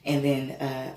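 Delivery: ordinary delivery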